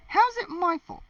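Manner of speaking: high, indignant mother voice; hammed-up indignant mom; mother's retort. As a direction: squeal it back defensively.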